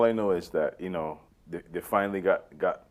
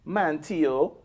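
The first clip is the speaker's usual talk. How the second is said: imitated Bajan accent, pitched high; 7 semitones higher than usual. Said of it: "only thing she got pretty right".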